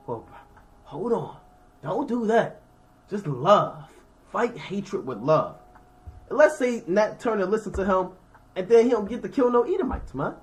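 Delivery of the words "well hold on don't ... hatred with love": calm preacher advice. Retold or imitated. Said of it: "What ... Martin Luther King gonna tell him?".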